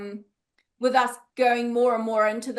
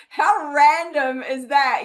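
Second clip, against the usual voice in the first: slow, loud, stretched-out disbelief; how random, said slow and loud in mock amazement; amazed; 6 dB louder, much slower than usual. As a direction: drag it out like you can't believe it. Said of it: "And I'm just like".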